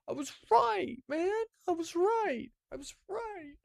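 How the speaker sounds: high, soft squeal of frustration; squeaky, wounded complaint; whiny protest. Real, not acted. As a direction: protest in a tiny high voice, fading away. Protests after running out of time.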